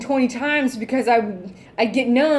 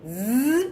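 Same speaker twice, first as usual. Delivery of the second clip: soft buzzing drill imitation; drill noise; 4 dB quieter than usual. Describing the quick fix to her partial, soft drill buzz. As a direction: buzz like a dentist's drill, softly. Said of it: "do a little drill".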